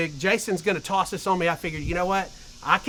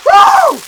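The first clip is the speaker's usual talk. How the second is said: shocked whoop; 16 dB louder than usual. Real, not acted. Ice water dumped on him.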